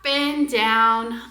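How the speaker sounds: slow, stretched up and down; slow exaggerated. Shown as a demonstration. Teacher modeling for parents, slow and stretched. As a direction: draw out each up and down. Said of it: "big movements".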